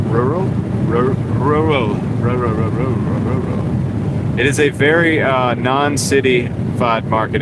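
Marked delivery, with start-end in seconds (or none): 0.1-2.0 rural mangled into roorl; fighting through the word rural; tongue-twisted rural. Shown as a demonstration. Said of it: "say rural five times fast". 2.2-4.4 rural rattled off fast, slurring into rr and ro; tongue twister rural, fading to a breath; rural five times fast. Shown as a demonstration. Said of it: "say rural five times fast".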